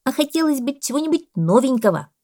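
firm parrot. A narrator's reading. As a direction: insist firmly as the parrot. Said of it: "твёрдо заметил попугай". (попугай, твёрдо, громко и высоко)

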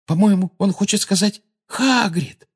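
high-pitched, voiced aside; urgent girl. A narrator's reading. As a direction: share a sudden realisation in a high voice. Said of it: "шепнула она".

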